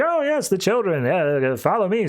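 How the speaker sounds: high, obliging helper voice; helpful robot. Retold or imitated. Robot guiding Cassandra to the children, high and helpful.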